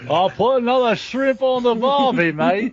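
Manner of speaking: put-on high-pitched jokey Australian accent; mock Australian accent. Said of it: "You know she's Australian".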